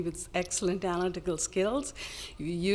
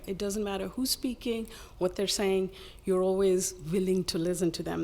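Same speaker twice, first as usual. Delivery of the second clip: ordinary talk, nothing marked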